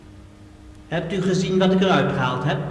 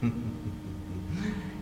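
low gloating chuckle; 9 semitones lower, 14 dB quieter than usual. Acted. Villain gloats over tapes.

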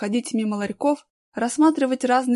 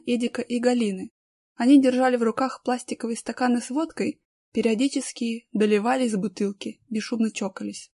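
usual speaking voice

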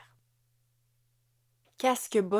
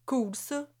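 offhand remark, pitched up; nonchalant; 6 semitones higher than usual. A narrator's reading. Husband reacting to wife's promotion, nonchalant. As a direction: toss it out casually. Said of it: "remarqua Jonathan, nonchalamment".

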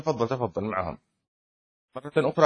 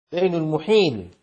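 ordinary delivery

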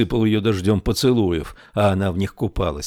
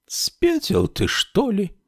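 put-on scoffing old woman; 13 semitones higher, slower than usual. A narrator's reading.

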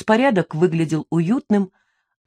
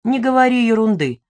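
mildly impatient, higher-pitched dismissal; 5 semitones higher, 4 dB louder than usual. A narrator's reading. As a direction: brush it off with a touch of impatience. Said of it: "отмёл Лёшка нетерпеливо".

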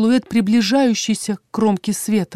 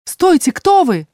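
high, louder, drawn-out plea; alarmed, pleading shout; urgent calling out; 8 semitones higher, 5 dB louder, slower than usual. A narrator's reading. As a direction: call after someone slipping away. Heroine calls after vanishing figure.

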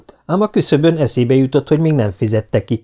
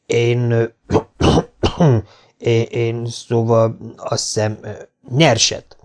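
halting, throat-clearing stammer; slower than usual. A narrator's reading. Flustered customer choosing sugar, stammering. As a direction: fumble toward the answer. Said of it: "hebegte Matthew".

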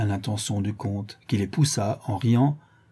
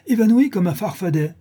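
higher and louder, excited general; eager blurt; 10 semitones higher, 6 dB louder than usual. A narrator's reading. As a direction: keep the breathless rush going. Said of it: "interrompit vivement Igor".

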